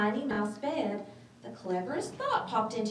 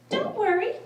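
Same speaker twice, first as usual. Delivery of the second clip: high, timid mouse voice; squeaky little mouse; 13 semitones higher, 5 dB louder than usual. Acted. Little mouse nervously asking the lion for help, squeaky. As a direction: ask politely in a tiny squeak.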